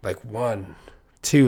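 ordinary talk, nothing marked

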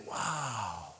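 soft, wistful wonder; hushed imitation of being impressed; retold awe. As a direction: murmur it as a longing aside. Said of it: "And I'm like".

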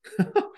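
sudden high laugh; burst of laughter. Real, not acted. Laughing at the thought of her eating someone.

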